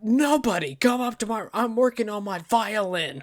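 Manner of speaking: high, loud, indignant shooing; mock fussy violinist. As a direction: shoo the intruder off in a high, loud mock huff. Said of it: "and he's just like".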